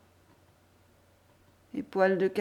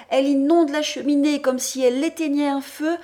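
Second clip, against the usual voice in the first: usual speaking voice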